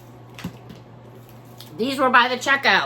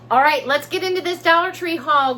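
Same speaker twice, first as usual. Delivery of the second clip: bright, higher, louder launch into the haul; excited kickoff; 6 semitones higher, 6 dB louder than usual. Real, not acted. Vlogger greeting her viewers, excited and louder. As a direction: pump up the viewers to start.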